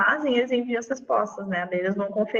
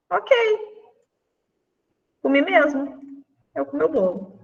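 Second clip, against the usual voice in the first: cheeky casual confession; 5 semitones higher than usual. Retold or imitated. Teacher voicing João admitting he ate the cake, casual. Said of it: "E disse de boa".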